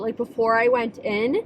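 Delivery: ordinary delivery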